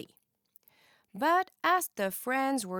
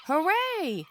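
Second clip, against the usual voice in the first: higher, louder hooray; a happy cheer; excited cheer; 7 semitones higher, 4 dB louder than usual. A narrator's reading. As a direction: burst out with joy. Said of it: "cried Summer".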